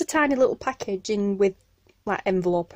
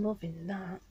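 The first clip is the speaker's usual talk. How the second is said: ordinary delivery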